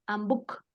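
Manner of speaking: "and book" tried quickly but still in two sounds; dos sonidos aun rápido. Shown as a demonstration. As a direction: hurry "and book", keeping the two words apart. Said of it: "Aunque lo quiera decir muy rápido". ("and book" rápido pero en dos sonidos)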